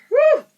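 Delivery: high loud yawning whoo; loud yawn whoop. Real, not acted. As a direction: let out a big yawn on woo. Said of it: "I'm just yawning".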